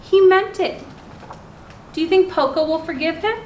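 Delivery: ordinary delivery, nothing marked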